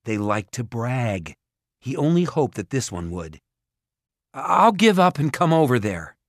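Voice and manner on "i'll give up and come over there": high, loud announcement; raised, urgent declaration; calling out surrender. A narrator's reading. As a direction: call it across to the ogre. Jared calls out his surrender.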